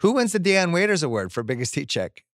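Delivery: ordinary talk, nothing marked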